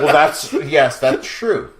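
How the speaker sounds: slow, loud laughing confession; laughing admission. Real, not acted.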